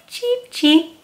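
high squeaky cheeping chick; chick cheeps. Acted. Reading aloud, cheeping like a little chick. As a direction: chirp it like a tiny chick.

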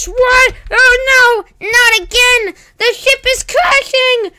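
high, loud terrified yelling; panicked screaming. Acted. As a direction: scream as the ship crashes. Spaceship crashing.

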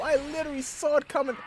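fast, high, loud protest; dismayed outcry. Real, not acted. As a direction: rush it out in loud disbelief. Dismay right after the trap.

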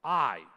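"I." said alone, loud and punched; a loud, emphatic "I." on its own. Shown as a demonstration. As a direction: slam down the word "I.". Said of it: "is meant to be emphatic".

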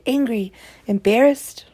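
normal talking voice

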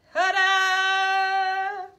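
loud, high showman reveal; proud ta-da. Acted. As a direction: present it like a magic trick. Final reveal of wings.

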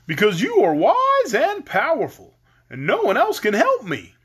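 high, loud, swooping voice; eager Scarecrow. A narrator's reading. Scarecrow appealing to the lady, eager. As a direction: flatter boldly, pitch leaping.

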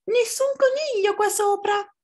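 very high-pitched squeaky little fox; small fox, high insistent voice. Acted. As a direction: pipe it up high like a tiny fox.

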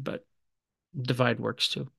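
usual speaking voice

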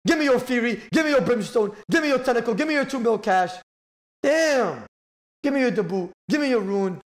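high, soft gloating chant; raging gamer. Real, not acted. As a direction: gloat over every item you take. Gloating over rival's lost loot.

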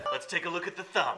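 loud, high, through laughter; laughing. Real, not acted. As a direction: try to deliver it while cracking up. Cast cracking up in outtakes.

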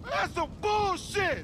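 high, slow indignant yell; angry complaint. Acted. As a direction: spit out the outrage. Angry complaint after failure.

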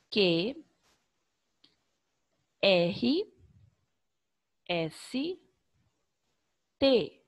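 each letter named slowly, one by one; teacher reading out the alphabet; slow alphabet recital. Shown as a demonstration. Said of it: "reciting the alphabet slowly".

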